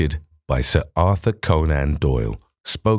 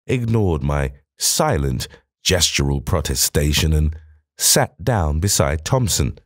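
usual speaking voice

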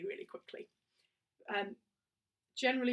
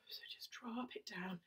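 whisper; 12 dB quieter than usual. Shown as a demonstration.

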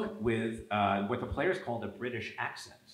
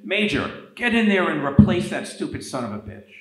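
loud, raised order to a player; frustrated coach; 7 semitones higher, 11 dB louder than usual. Retold or imitated. Coach sending in a player mid-game, retold frustrated shout. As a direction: imitate a fed-up coach ordering a substitution. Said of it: "he got very frustrated".

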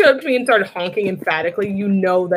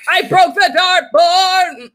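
excited shout; 8 semitones higher, 5 dB louder, slower than usual. Real, not acted. Shocked at breaking the dartboard.

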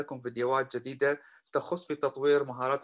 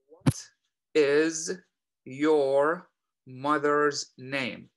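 very slow, word by word; slow model question; 4 dB louder, much slower than usual. Shown as a demonstration. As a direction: draw each word out for learners. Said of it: "هنا السؤال الأول". (نطق بطيء جدًا كلمة كلمة)

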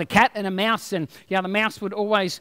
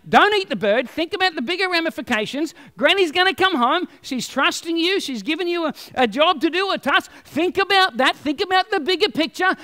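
higher, urgent angel voice; pleading angel; 6 semitones higher than usual. Acted. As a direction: plead with the cat to think. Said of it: "the angel one is saying".

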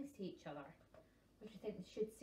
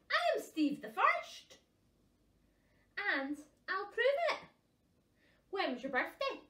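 high, loud bragging bird voice; boastful puffin; 8 semitones higher, 11 dB louder than usual. Acted. Puffins arguing who is Steve, loud boasting. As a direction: crow it over the other puffin.

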